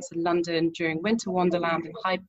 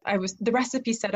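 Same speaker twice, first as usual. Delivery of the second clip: usual speaking voice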